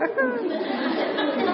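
high, drawn-out fake jeering laugh; mocking laugh. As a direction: laugh at a classmate in play. Acted jeering laugh at a friend. (tawa mengejek bernada tinggi)

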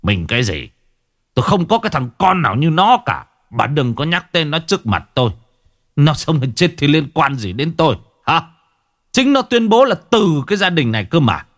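disown the son in a heated rant; growled anger. A narrator's reading. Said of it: "Lão gằn giọng". (gằn giọng giận dữ)

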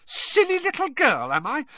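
very high, loud, indignant echo; shaking with rage. A narrator's reading. Hermione echoing the reporter's insult, shrill and outraged. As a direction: throw the insult back shrilly. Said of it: "shaking with rage".

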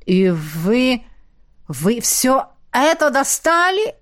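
slow, hoarse, strangled question; a light rasp of disbelief. A narrator's reading. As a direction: barely squeeze the words out. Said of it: "просипела наставница".

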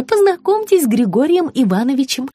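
high-pitched sugary voice; high fawning woman. A narrator's reading. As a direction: gush like an eager hostess. Margarita's voice, high.

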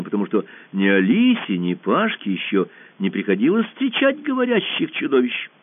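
ordinary delivery, nothing marked

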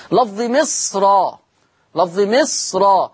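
مصر read slowly with a full heavy ر. Shown as a demonstration. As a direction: stretch مصر and keep its ر thick. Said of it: "قرأ ورش بتفخيم الراء". (تفخيم الراء في مصر ببطء)

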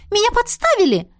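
high, panicked squeak; frightened squeak. A narrator's reading. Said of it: "судорожно пискнула". (испуганный писк)

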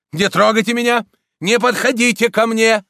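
high, loud pleading cry; frightened shout. A narrator's reading. Priest surrounded by the crowd, frightened shout. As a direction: keep the mob back in panic. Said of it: "он закричал".

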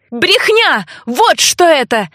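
high, sharp outburst of contempt; blurted angry exclamation; angry cry. A narrator's reading. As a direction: cry it out, pitch leaping up on the first word. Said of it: "закричала я, отбросив необходимость подбирать слова".

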